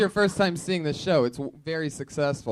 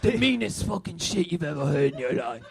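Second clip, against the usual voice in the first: aggrieved English guest impression; mock British accent. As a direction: laughingly ham up a wounded Brit. Host parodies British guest's complaint.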